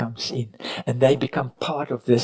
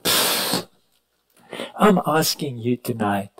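usual speaking voice

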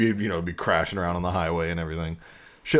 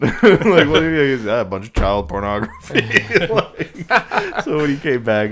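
laughing through it; 7 semitones higher, 7 dB louder than usual. Real, not acted. Retelling a prank, laughing through it.

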